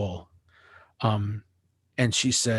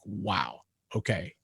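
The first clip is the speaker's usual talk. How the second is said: much softer, lower, deflated; stunned hush; 3 semitones lower, 8 dB quieter, slower than usual. Retold or imitated. Stung by the bandleader's line, quietly stunned. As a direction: let the air out, stunned.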